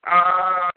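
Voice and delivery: high, drawn-out cry of frustration; long high groan of defeat; frustrated "aah", held high; exasperated wail. Real, not acted. Gives up on disobedient dog.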